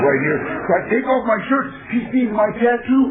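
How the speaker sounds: ordinary delivery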